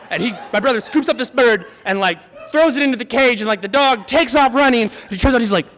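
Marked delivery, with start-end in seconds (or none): none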